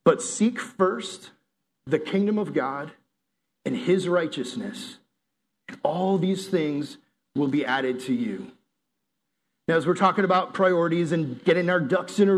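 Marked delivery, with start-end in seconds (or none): 0.0-8.5 slow, weighty reading of scripture; measured Bible reading with long pauses. Shown as a demonstration. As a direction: read the verse slowly, letting each phrase land. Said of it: "It says this".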